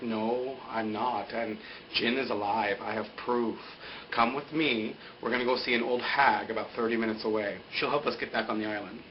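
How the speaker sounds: low, soft, measured retold reply; calm character voice. Acts out Ben's calm answer.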